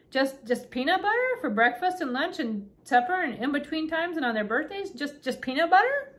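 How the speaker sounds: high, rising disbelief; baffled, climbing question; incredulous. A narrator's reading. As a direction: list it in growing astonishment. Ellie astonished at the diet.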